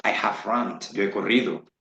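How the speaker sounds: ordinary talk, nothing marked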